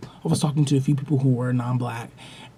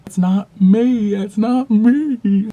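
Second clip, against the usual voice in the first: Carlton; 7 semitones higher, 5 dB louder, slower than usual. Pretend. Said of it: "I gotta put on my Carlton".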